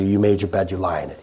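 a hint of a cold father laying down the law; dropping low and quiet like a dismissive dad; stern father. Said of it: "your father may have said to you".

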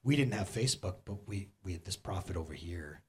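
a hint of Jesus gently reassuring; Jesus speaking. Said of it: "this is what Jesus would say to you".

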